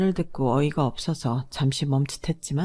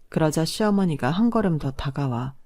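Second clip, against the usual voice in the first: plain reading voice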